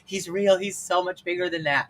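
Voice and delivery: crying out in relieved disbelief, half sobbing; happy tears. Retold or imitated. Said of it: "I was bawling now, like, happier tears".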